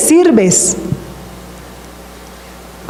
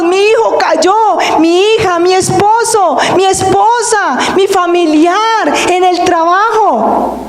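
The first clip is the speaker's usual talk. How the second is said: pleading cry, pitched higher; clamando; 6 semitones higher than usual. Retold or imitated. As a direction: cry out to God for your family. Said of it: "le clamamos". (suplicando con voz más aguda)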